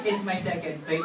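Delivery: low, soft mock-innocent answer; comic understated excuse; sheepish reply. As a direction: play the caught diner, dropped down low. Acts the woman's sheepish reply.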